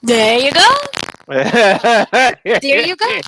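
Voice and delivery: loud, rising cheer of agreement; delighted agreement. Real, not acted. Co-host guesses the devil deal, loud delighted agreement. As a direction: exclaim it loudly and draw it out.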